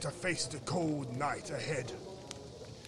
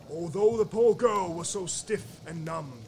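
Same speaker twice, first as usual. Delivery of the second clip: usual speaking voice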